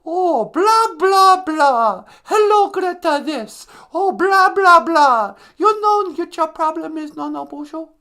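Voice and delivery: loud, high-pitched mock tirade; high, hammed-up activist outburst; Greta Thunberg. Greta scolds the show's host, mock high-pitched ranting. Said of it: "Greta Thunberg".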